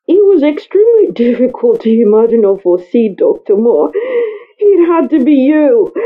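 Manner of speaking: ordinary delivery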